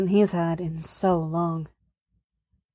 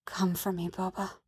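very soft, hushed coaxing; intimate murmur; 13 dB quieter than usual. A narrator's reading. She speaks against his neck, murmuring very softly. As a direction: coax gently, barely above a whisper.

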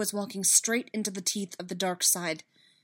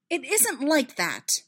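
sharp, drawn-out denial, raised in pitch; louder, higher sharp retort; sharp protest; 6 semitones higher, 5 dB louder, slower than usual. A narrator's reading. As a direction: insist sharply, stretching the words. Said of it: "Padme said sharply".

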